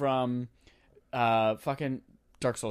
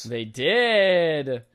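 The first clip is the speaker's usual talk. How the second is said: loud, drawn-out, higher exclamation; excited cheer; 4 semitones higher, 6 dB louder than usual. Pretend. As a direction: cheer the news, stretching DID. Mock-excited reaction to the news.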